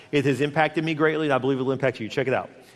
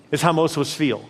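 ordinary talk

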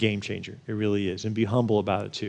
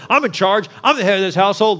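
higher, louder mock declaration; bossy announcement; 7 semitones higher, 5 dB louder than usual. Husband walking into his house to his family, mock bossy. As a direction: throw your weight around, pitched up and loud. Acting a domineering head of house.

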